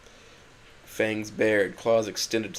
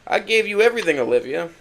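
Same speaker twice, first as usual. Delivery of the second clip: higher and louder, voice cracking in anger; hurt outburst; 7 semitones higher, 7 dB louder than usual. Acted. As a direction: throw the words at her, wounded. Hurt, angry plea to Olivia.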